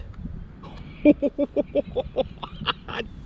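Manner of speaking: high, giddy stuttering laugh; excited laugh. Real, not acted. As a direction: laugh in high breathy bursts at a thrilling find. Amazed reaction to the find.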